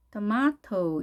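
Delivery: British tomato, tuh-MAH-toh with a clear T; British style. Shown as a demonstration. Teacher showing the second way, British tomato as tuh-MAH-toh. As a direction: say tomato in British English, long AH. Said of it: "영국식 발음인데요".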